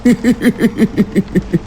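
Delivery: eerie high-pitched giggling; high giggle. A narrator's reading. Strange man giggling at the narrator, eerie high giggle. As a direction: giggle like a ghost in the dark. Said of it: "খিলখিল করে হেসে উঠল".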